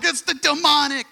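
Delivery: high-pitched imitation of spooked people; hammed-up panic, pitched well up; mock alarm. Mimicking believers who get all worked up about demons, mock alarm.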